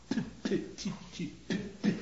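voiceless little bursts of "p" and "t"; spat consonants. Shown as a demonstration. Lecturer showing the sounds an actor spits, spat consonants. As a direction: spit out bare "p" and "t" sounds, softly. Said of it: "has to spit them out".

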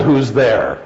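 ordinary delivery

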